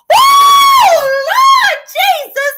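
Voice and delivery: high, far-reaching cry of disbelief; shocked shout. Real, not acted. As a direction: scream it to the heavens. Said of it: "I'm fanning myself".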